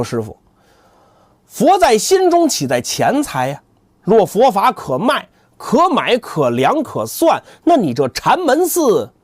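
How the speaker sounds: high, slow righteous retort; solemn monk rebuke. Acted. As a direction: rebuke with measured dignity. 三藏抢白. (缓慢庄重的反驳)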